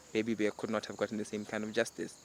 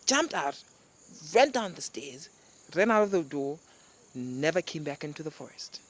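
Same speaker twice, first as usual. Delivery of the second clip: ordinary delivery, nothing marked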